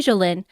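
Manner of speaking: Jolene said as "joh-LIN"; mispronounced name. Real, not acted. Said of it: "I've been saying it wrong".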